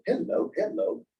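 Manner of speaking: high, airy imitation of Tom's "Hello, hello"; sing-song hello. Tom greeting everyone at the conference, mild sing-song. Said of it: "here's Tom out there going".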